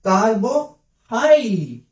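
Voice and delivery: कार्बो हाई said slowly, two clear parts; कार्बो हाई stretched out for learners; slow syllable reading. Shown as a demonstration. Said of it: "तो वही तो है".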